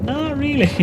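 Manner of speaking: high-pitched dismissive quote; mock friends' denial. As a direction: squeak out a friend's doubtful reply. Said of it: "my Irish friends are like".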